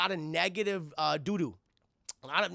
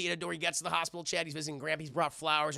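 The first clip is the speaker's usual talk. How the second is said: usual speaking voice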